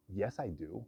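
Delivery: usual speaking voice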